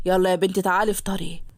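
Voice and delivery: low, fatherly call to his daughter; father's voice. Acted. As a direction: speak as a gruff Egyptian dad. Father calls daughter to breakfast.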